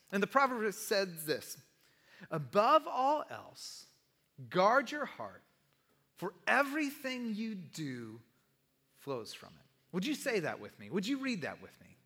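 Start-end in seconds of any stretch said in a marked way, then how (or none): 2.3-9.7 solemn reading. Real, not acted. Reads key proverb solemnly.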